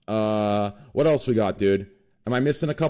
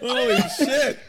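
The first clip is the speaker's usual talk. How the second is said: very high-pitched laughing exclamation; laughing disbelief; 14 semitones higher, slower than usual. Real, not acted. Host reacting to a guest's wild robbery story, laughing disbelief. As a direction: squeal it out through laughter.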